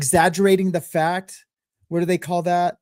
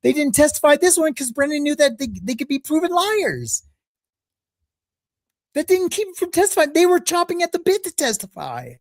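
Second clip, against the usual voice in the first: pitched far up, indignant rebuttal; high-pitched protest; 12 semitones higher than usual. Real, not acted. Host rebutting the clip about witnesses testifying, in high-pitched protest. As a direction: push the objection way up high.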